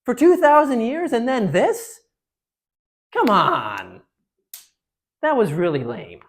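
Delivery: loud, high disbelief dropping to disgust; exasperated, stretched-out outburst; incredulous historians. Acted. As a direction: react as if hugely let down. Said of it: "historians look back on one day and say".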